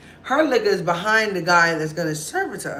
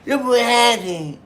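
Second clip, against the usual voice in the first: put-on drunk woman voice; 5 semitones higher, 5 dB louder than usual. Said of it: "drunk woman".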